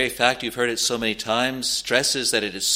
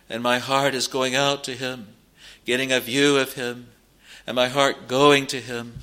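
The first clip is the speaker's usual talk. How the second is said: normal talking voice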